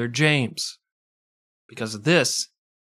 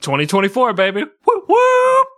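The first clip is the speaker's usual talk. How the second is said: loud, much higher whooping cheer; mock cheer; 11 semitones higher, 9 dB louder than usual. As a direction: whoop it up ironically.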